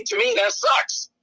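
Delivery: ordinary talk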